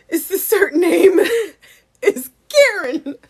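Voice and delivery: high, loud, through real laughter; laughing. Real, not acted. Naming the colonizing avatar Karen, laughing. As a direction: squeal the words while cracking up.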